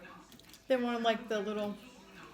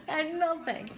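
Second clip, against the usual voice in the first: amused; 7 semitones higher, slower than usual. Real, not acted. Still cracking up at the story.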